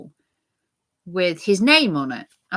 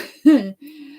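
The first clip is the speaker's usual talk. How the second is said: bright laugh; 8 semitones higher, 7 dB louder than usual. Real, not acted. Laughs at her own joke.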